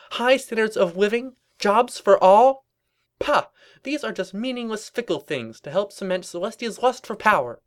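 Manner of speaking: high, ringing protest speech; defiant revolutionary pony. Acted. Prisoner denouncing tyrannical dictatorship, high defiant rant. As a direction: declaim each demand at high pitch.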